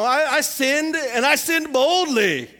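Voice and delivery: slow, loud, laughing brag; defiant sinner boasting about it. Retold or imitated. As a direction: own it proudly with a laugh. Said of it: "It was that idea of".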